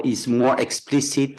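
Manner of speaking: normal talking voice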